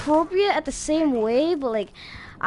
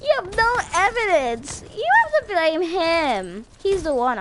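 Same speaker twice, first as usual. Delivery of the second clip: mocking innocent protest; 7 semitones higher than usual. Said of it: "they try to act like".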